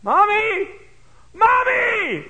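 put-on high, loud lost-child cry of Mommy. Lost boy in store calling for his mother, loud high cry. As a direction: call out for mother in panic.

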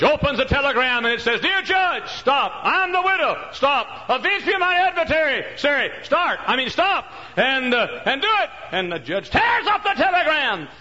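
high, loud shouted telegram; preacher yelling the widow's telegram; full-force preaching. Acted. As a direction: shout each line of the telegram. Preaching climax reading telegram.

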